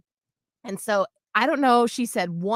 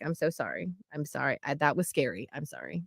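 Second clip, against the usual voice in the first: hushed, low, soothing; tender apology; 8 semitones lower, 9 dB quieter than usual. Retold or imitated. Mom cuddling her daughter after the scare, tender. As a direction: murmur it softly, much lower and quieter. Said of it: "I hugged her and I cuddled her".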